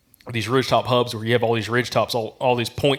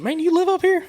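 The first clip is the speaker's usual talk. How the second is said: much higher, mock surprised local voice; imitating local hunter; 3 dB louder than usual. Iowa local asking a stranger, voiced much higher. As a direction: pitch way up to play the Iowa guy. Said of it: "He's like, he's like".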